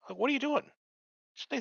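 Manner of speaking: high-pitched incredulous scolding; mock exasperation. Mock scolding of gracias-sayers.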